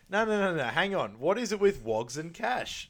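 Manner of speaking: hammed-up disbelieving Farouk; mock protest. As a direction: stop him mid-offer, half laughing. Character quoted protesting cash payment.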